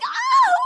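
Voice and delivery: sudden loud high ow; pained yelp. Acted. Cut off mid-sentence, a sharp high yelp of pain. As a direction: break off with a sharp cry.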